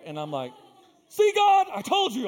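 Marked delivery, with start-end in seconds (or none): 1.1-2.3 high, loud, exasperated yell; furious outburst. Retold or imitated. Retelling his complaint to God after the awkward witness, furious. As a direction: shout it up at God in indignation. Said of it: "I was so mad. I was furious".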